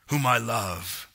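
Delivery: slow, solemn pronouncement from heaven; measured movie-style God voice. Acted. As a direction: speak slowly and deliberately as God. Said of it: "it just sounds like God".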